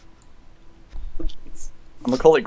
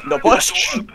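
put-on Russian accent; 7 semitones higher than usual. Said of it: "speak in a Russian accent".

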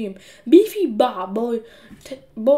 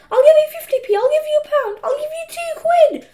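put-on very high, pleading little boy; desperate bargaining; 6 dB louder than usual. A narrator's reading. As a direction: raise the offer in squeaky panic. Peter raises his desperate bids.

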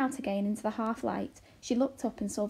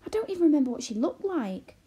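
high, loud, bewildered protest; agitated, swooping disbelief; exclaiming; 8 semitones higher, 6 dB louder than usual. A narrator's reading. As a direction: insist in dismay. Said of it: "exclaimed Arrietty".

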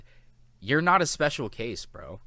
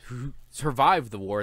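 usual speaking voice

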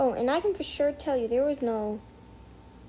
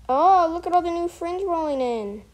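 higher and louder delight; thrilled, bouncing exclamation; excited; 6 semitones higher, 7 dB louder than usual. Real, not acted. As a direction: gush over the new friends. Friend requests arrive.

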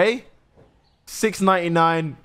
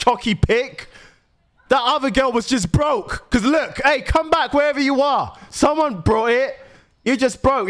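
high-pitched, affronted heckling; indignant rant; 7 semitones higher than usual. Pretend. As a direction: call after a customer who wouldn't pay. Ranting after the customer who left.

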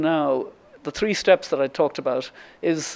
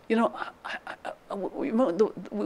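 soft, halting start; tentative, higher and hushed; hesitant; 5 semitones higher, 7 dB quieter than usual. Retold or imitated. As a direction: stammer softly in a higher voice. Acting out her friend Maeve hesitating.

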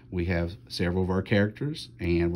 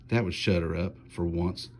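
ordinary delivery

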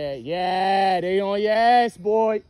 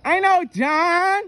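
slow, high, stretched-out mockery; slow mocking singsong; drawn-out taunt; 6 semitones higher, much slower than usual. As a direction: drag each word out to needle him. Prank host mocks the name.

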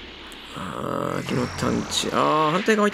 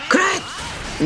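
shouted attack; much faster than usual. Acted.

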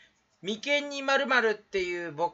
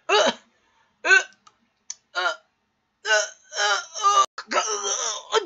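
high, loud strained grunts; choked groans; 12 semitones higher, 6 dB louder, much faster than usual. Acted. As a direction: groan in bursts as if struck by sudden agony. Gags and groans after mishap. (苦しげに高くうめく)

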